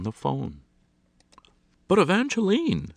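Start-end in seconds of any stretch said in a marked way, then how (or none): 1.9-2.9 high, loud indignant protest; a scolding mistress pulled away from her guests; expostulating. A narrator's reading. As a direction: protest loudly, pitched right up. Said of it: "expostulated Missus Galante".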